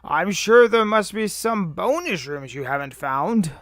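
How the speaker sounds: put-on high, very loud old-timer voice; Cranky. Player reading the old man's taunt, loud character voice. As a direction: needle them as a loud old codger.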